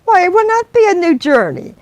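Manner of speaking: high, loud indignant refusal; indignant Janice. Acted. Passenger refusing the driver's extra pound, loud and indignant. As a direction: refuse flatly, affronted.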